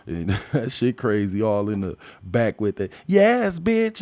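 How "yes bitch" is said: higher, louder sassy cheer; mock cheering ally. Mocking friends cheering in the background, loud sassy yes. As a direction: hype it up like a gossiping sidekick.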